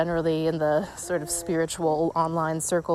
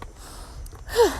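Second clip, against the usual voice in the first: breathless sigh. Real, not acted. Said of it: "out of breath walking up hills".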